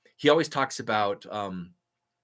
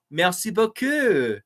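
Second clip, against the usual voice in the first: put-on French accent; 7 semitones higher, 5 dB louder, slower than usual. Thanking a French viewer.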